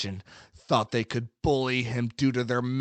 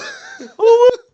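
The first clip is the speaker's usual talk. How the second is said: real loud, very high hoot; celebratory whoop; 14 dB louder than usual. Real, not acted. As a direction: whoop in high-pitched glee. Celebrating after the take.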